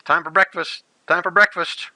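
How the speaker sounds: mild acted morning call; wake-up call. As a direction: call out lightly to rouse the sleepers. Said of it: "waking everybody up".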